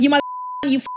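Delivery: re-enacted angry swearing; mock cussing. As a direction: spit out a curse, cut short. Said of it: "this is how I'm cussing".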